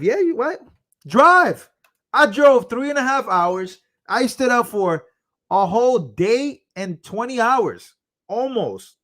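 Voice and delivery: high-pitched, worked-up protest; indignant, high and emphatic; incredulous retort. Real, not acted. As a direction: fire back in disbelief, pitch way up. Indignant answer to donor's driving wish.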